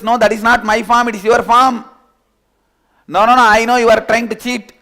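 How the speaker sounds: high, loud protest; loud denial. Acted. The two shout denials back and forth. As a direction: deny it hard and loud.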